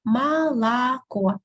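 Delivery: slow "Молоко" as ma-la-KO; unstressed O as A. Shown as a demonstration. Teacher showing three letters O, slow "Молоко" as ma-la-KO. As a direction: draw out "Молоко", first two vowels ah, last oh. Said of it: "first two I pronounce as A".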